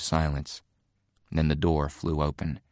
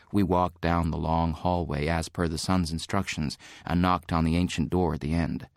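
plain reading voice

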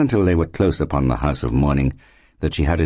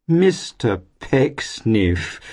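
high, drawn-out greeting in a gushing landlady voice; gushing landlady's welcome; effusive cry; 8 semitones higher, much slower than usual. A narrator's reading. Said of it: "cried Missus Todgers".

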